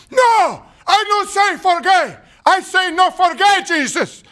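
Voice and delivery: put-on high, loud Cuban-accented protest; slow, bellowed imitation with heavy Cuban accent; Yoel Romero. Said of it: "in this heavy accent".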